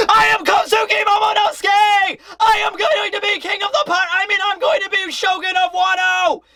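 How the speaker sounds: high-pitched, strained mock declaration; Momonosuke's yell. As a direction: yell it proudly to a whole crowd. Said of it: "he yells that to the whole crowd".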